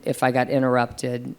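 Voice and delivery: ordinary delivery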